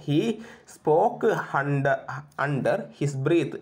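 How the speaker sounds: very slow, word by word; slow repetition. Shown as a demonstration. Teacher repeating the English phrase before translating it, slowly drawn out. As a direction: stretch each word for the class.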